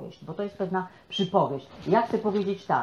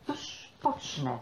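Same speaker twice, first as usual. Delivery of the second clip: slow quiet musing; 3 semitones lower, 8 dB quieter, much slower than usual. Retold or imitated. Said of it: "to sobie pomyślał tak".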